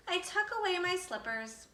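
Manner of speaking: ordinary delivery, nothing marked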